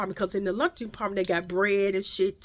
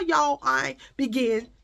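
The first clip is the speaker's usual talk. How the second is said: ordinary delivery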